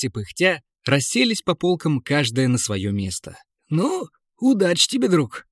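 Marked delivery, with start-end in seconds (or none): 3.7-5.5 bright, cheery encouragement; encouraging. A narrator's reading. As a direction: cheer a friend on. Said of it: "подбодрил торговец".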